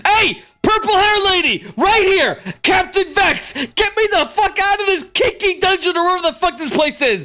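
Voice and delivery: high frantic full-voiced yelling. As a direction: holler excitedly through the bars to get attention. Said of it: "you see Vex yelling".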